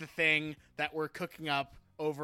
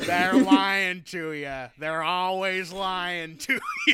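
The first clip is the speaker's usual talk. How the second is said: Roz voice; 3 semitones higher, 8 dB louder, slower than usual. Retold or imitated. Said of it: "she's like".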